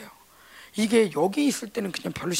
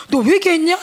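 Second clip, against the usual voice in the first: stern pastor; 7 semitones higher, 8 dB louder than usual. Retold or imitated. Husband pastor sternly asking if she repented. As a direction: demand it flatly, unimpressed.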